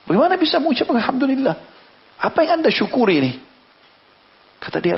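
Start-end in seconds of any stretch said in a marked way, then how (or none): 0.1-3.3 incredulous question. Acted. Man baffled by the father's praise.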